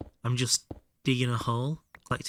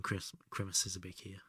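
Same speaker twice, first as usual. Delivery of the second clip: whisper; 3 semitones lower, 9 dB quieter than usual. Real, not acted. Aside to chat.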